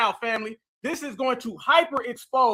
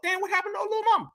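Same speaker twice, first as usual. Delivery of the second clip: much higher and fast, caught off guard; startled exclamation; 9 semitones higher, 4 dB quieter, much faster than usual. Real, not acted. Reacting to a glitching video on his screen, startled and fast. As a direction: blurt it out as the clip suddenly jumps.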